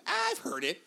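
high, drawn-out exclamation; high-voiced comic insistence; retold protest. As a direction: pitch it way up, playfully emphatic. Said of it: "and I was like".

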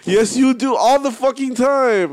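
high, loud, drawn-out contradiction; emphatic insistence. Real, not acted. Friend contradicting a denial of the habit, loud exasperated insistence. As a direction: shout the friend's denial down, slowly.

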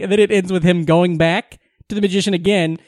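usual speaking voice

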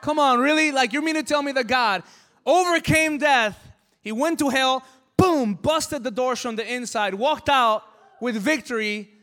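loud, high impassioned preaching; incredulous shouting. Real, not acted. Preacher challenges doubters of God's victory over death, shouting incredulously.

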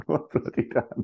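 mock outrage lightly; Sheffield impression. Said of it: "Sheffield is".

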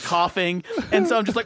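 ordinary talk, nothing marked

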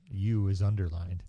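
usual speaking voice

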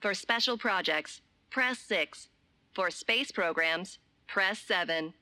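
automated recorded menu voice; robotic hotline recording, evenly paced; phone menu voice. Acted.

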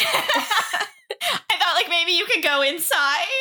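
giggling through the words; nervous laugh while asking; laughing nervously. Acted. Laughing as she asks a friend to go in.

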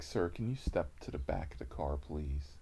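low, soft polite order; restrained cop. Retold or imitated. Officer asking driver to step back, low and quiet.

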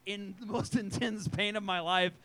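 normal talking voice

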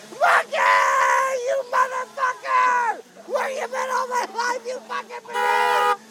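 excited yelling. Real, not acted. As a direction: yell at the scare actor with glee. Greeting a clown scare.

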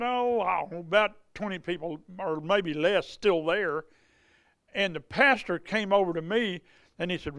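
usual speaking voice

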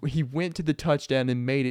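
ordinary talk

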